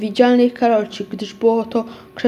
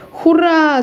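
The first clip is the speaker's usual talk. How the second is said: joyful shout; 6 semitones higher, 5 dB louder than usual. A narrator's reading. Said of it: "zawołał wtedy Piotr, podskakując z zachwytu".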